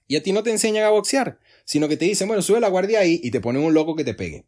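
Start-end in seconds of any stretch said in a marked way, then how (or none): 2.3-3.2 loud, high-pitched instructor order; boxing coach command. Acted. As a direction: call it out like a coach to a beginner. Acted boxing coach giving orders.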